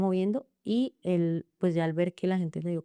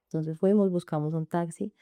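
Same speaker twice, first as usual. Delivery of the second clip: ordinary talk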